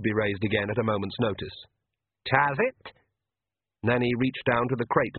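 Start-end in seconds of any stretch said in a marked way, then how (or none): 2.3-2.9 tiny Scotsman. A narrator's reading. Tiny Scots warrior asks.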